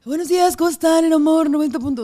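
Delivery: saludo de radio ronco, más agudo y fuerte; voz de locutora ronca. Shown as a demonstration. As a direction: imita su voz gastada al aire. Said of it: "Ya hablaba así". (locutora ronca, aguda y fuerte)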